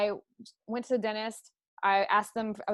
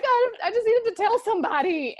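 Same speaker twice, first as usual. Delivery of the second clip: high, loud tearful voice; mock tearful plea; 13 semitones higher, 7 dB louder than usual. Woman recalling her first crying video, high tearful plea. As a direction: act out blurting it through tears. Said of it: "And I'm like".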